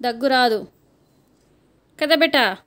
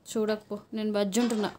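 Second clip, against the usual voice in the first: pretend sulk; 4 semitones lower, 8 dB quieter than usual. Pretends sulking off to sleep.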